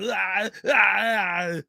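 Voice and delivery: strained grunting ah ah ah, imitating Arnold; mock the nose-device grunts from the movie; Schwarzenegger impression. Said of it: "He has that thing in his nose".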